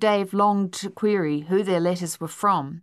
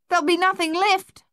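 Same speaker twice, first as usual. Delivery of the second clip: high, loud shout to mates; urgent yell; 12 semitones higher, 5 dB louder than usual. A narrator's reading. Luther pulling off boots to join the race, yelling. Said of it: "Luther yelled".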